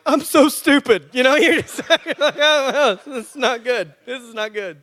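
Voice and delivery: loud, high, hammed-up wail; hammed-up panic. Speaker mocking his own panic over a problem, hammed-up wail. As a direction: play a grown man's comic meltdown. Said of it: "And I'm like".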